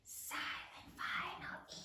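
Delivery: silent final E whispered; whispered spelling cue. Shown as a demonstration. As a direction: whisper it, since the letter is silent. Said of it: "marking the silent letter".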